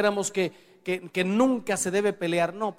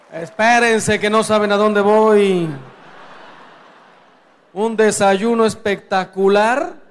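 loud call over the crowd; projected, loud interjection; 3 semitones higher, 9 dB louder than usual. Real, not acted. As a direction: raise your voice to hold off the audience. Calling over a laughing audience.

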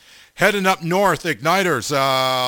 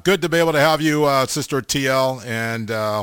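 usual speaking voice

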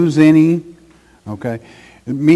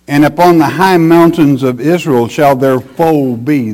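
loud, ringing proclamation of the verse; scripture reading; 2 semitones higher, 6 dB louder than usual. Acted. Preacher reading God's promise to gather the flock, declamatory. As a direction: declaim it forcefully, easing at the close.

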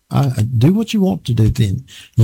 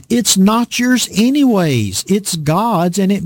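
slow, raised preaching plea; 5 semitones higher than usual. Acted. Preacher urging the congregation to let the ox eat, raised and slow. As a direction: lift it up and press it home, slowly.

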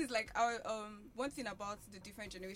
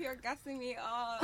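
ordinary talk

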